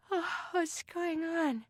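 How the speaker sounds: slow, high, drowsy voice; sleepy young girl. Acted. Beth woken as Sarah joins the bed, sleepy. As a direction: drag it out high, half asleep.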